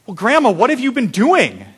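exaggerated puzzled grandson; mock incredulous question. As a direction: ask grandma in playful disbelief.

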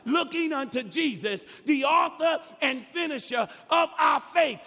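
high, driving, half-shouted scripture delivery; full-force preaching. Real, not acted. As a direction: push toward a climax, stretching the final cry.

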